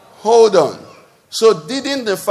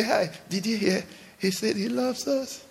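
soft, hushed sharing of news, much softer; hushed gossip; 3 semitones higher, 13 dB quieter than usual. Retold or imitated. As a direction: lean in and pass on the secret quietly. Retelling excited whispered gossip.

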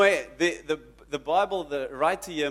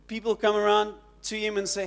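ordinary talk, nothing marked

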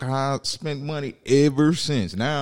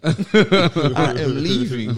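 slow, louder, through laughter; laughing emphasis; 6 semitones higher, 7 dB louder, much slower than usual. Retold or imitated. Son retelling his walk-off, slow and laughing. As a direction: stretch it out while cracking up. Retold exit repeated amid laughter.

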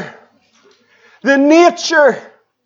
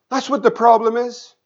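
normal talking voice

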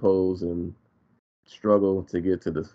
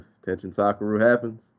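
ordinary talk

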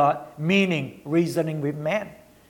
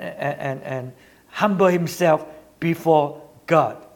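normal talking voice

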